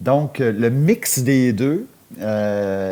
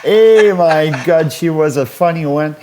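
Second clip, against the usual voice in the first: laughing goodbye; 4 semitones higher, 6 dB louder than usual. Real, not acted. Host laughs after caller.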